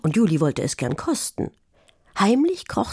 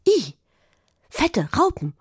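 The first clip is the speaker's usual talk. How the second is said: disgusted yelp; 10 semitones higher than usual. A narrator's reading. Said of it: "schrie Carla".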